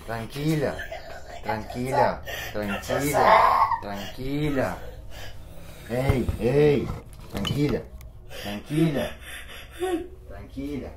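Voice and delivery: calm her firmly, loud enough to cut through; firm, loud reassurance while she screams. Real, not acted. Calming her over her screams.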